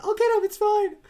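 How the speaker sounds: very high, loud, hammed-up reassurance; mock insistence. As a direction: brush off the objection in a squeal. Retold insisting, pet-like fussing.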